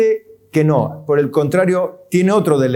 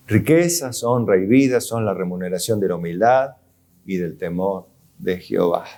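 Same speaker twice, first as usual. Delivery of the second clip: a quiet, lower second reading; repeated scripture, softer and lower; 7 semitones lower, 4 dB quieter than usual. Acted. Preacher repeats the verse softer to his congregation.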